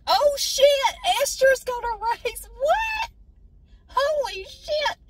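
very high and loud, utterly floored; stunned shock. Retold or imitated. Watching the grandmotherly Esther pull out her own racing helmet, stunned. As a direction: gasp it out like you can't believe your eyes. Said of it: "I'm like".

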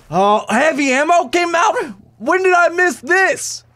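high-pitched, incredulous yelp; indignant surprise. Real, not acted. Gamer discovering he missed heavy ammo, high disbelief.

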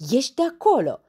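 loud, high scolding burst; snapping angrily. Acted. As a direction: order him out sharply. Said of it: "se răsti la el". (răstit, tare și înalt)

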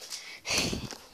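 breathy, voiceless laugh; short real laugh at own prank. Real, not acted. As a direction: let out an airy little laugh. Amused by own prank plan.

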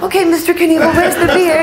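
high, giggling through the words; laughing reply. Retold or imitated. Reporter answering the stuntman's beer demand, laughing and high.

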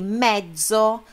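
MEZZO as MED-DZO, held and strong; double consonant. Shown as a demonstration. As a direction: linger slowly on the double Z of mezzo. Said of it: "Lembra que eu falei da dupla consoante?". (mezzo com Z duplo, forte e demorado)

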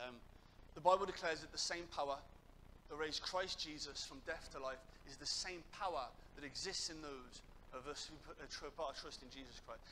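ordinary talk, nothing marked

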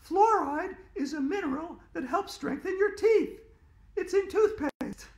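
put-on very high, loud bossy little girl; girl corrects a classmate, high and bossy; snooty know-it-all girl. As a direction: squeak it out, correcting him with scorn. Andrea lecturing.

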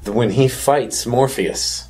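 ordinary talk

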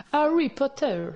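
put-on "Harry Potter" in a light posh English accent, H breathed, tongue-tip R; snob English accent. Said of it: "Dites Harry Potter avec un accent anglais snob". (accent anglais snob sur « Harry Potter »)

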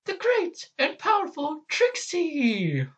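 very high, slow showy announcement; Trixie. Acted. Boastful magician announcing herself, high drawn-out flourish. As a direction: proclaim yourself grandly in a shrieky high voice. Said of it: "I could try Trixie's voice".

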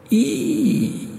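very high, long squeal; piercing shriek. A narrator's reading. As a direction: shriek like steel scraping on steel. Voices shriek in his head.

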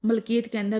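ordinary delivery, nothing marked

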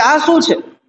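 toned-down narrated shriek; mild frightened cry. A narrator's reading. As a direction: voice a mother's scream in understated form. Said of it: "એને બીક લાગી અને તરત જ એને ચીસ મારી".

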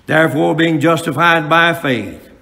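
loud, ringing sermon delivery; preaching at full force. Acted. Preacher to his congregation, preaching loud and full.

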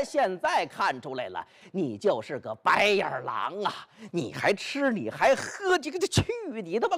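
can't get the words out for rage; furious cursing. Acted. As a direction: brother cursing the other across the table. Xu Qing curses Bai Yutang.

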